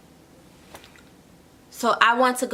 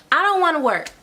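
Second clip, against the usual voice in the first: higher, mock whiny I don't wanna; reluctant whine; 5 semitones higher, faster than usual.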